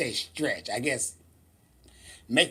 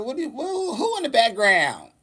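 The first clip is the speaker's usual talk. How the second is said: much higher, startled question; surprised; 8 semitones higher, 4 dB louder than usual. Retold or imitated. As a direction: snap round in surprise. Retold reaction to barber call.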